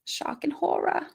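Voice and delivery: whispered, sarcastic shock and horror; mock whisper. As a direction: breathe it like fake horror.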